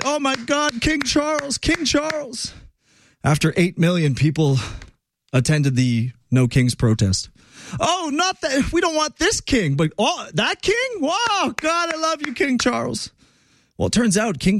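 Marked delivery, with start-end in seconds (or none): 0.0-2.6 high, thin falsetto fangirl gushing; mock adoring fan. Host mimics Congress cheering for the king, mock adoring falsetto. Mocking an adoring standing-ovation crowd. 7.8-13.1 very high falsetto, hammed-up swooning; mock fickle protesters. Host mocks No Kings protesters fawning over Charles, hammed-up falsetto gushing. Mocking protesters who adore another king.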